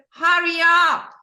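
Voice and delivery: loud, slow, high call to hurry; mock shout from far away; mother's call. Mom calling her daughter back, loud. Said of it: "And she said".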